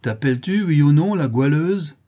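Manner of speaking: loud, high demand for an answer; angry challenge. A narrator's reading. Milkmaid publicly challenges Marie to confess. (sommation coléreuse)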